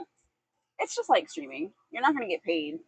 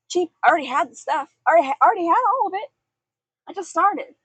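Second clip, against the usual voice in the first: excited; 8 semitones higher, 7 dB louder, faster than usual. Real, not acted. Excited streaming origin story.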